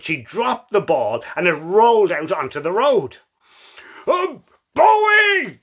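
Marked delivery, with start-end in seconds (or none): 4.1-5.6 high, loud scream of a name. Acted. Girl screaming for her dog near road, high shriek. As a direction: shriek the dog's name in panic. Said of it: "she screamed".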